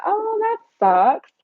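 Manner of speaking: drawn-out, high "oh" of sympathy; disappointed reaction. Real, not acted. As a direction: let the letdown stretch out, pitched high. Learns viewers cannot donate.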